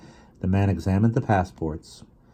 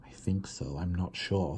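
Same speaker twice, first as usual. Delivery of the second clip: hushed and unsure; 10 dB quieter than usual. A narrator's reading. Mama uncertain, hushed.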